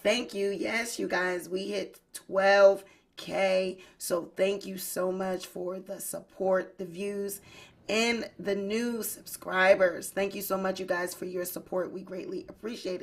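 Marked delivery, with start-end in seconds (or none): none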